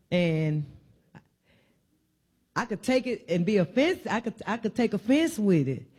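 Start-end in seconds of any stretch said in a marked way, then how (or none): none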